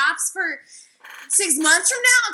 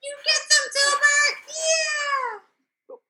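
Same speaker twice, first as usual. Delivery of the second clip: excited cheering; 11 semitones higher, much slower than usual. Acted. Cheering on Filbert.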